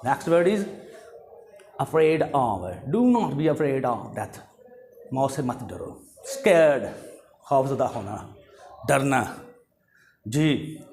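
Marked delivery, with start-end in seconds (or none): none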